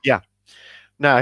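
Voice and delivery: usual speaking voice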